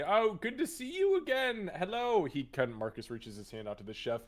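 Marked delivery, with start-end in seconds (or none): 0.0-2.2 high, loud gushing hello; effusive greeting. Acted. Marcus greets acquaintances in passing, high and effusive.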